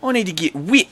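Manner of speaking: loud, high-pitched Kiwi accent; hammed-up New Zealand bro, high and loud. Shown as a demonstration. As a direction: cry out in a put-on Kiwi voice. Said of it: "I'm doing a New Zealand accent".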